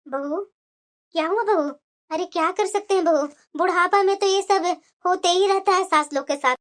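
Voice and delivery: put-on elderly mother-in-law.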